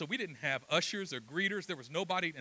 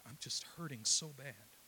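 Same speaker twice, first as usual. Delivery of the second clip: soft, lowered voice of a hurting man; tearful confession; 4 semitones lower, 8 dB quieter than usual. Retold or imitated. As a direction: retell a tearful admission, hushed. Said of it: "tears started falling down his face".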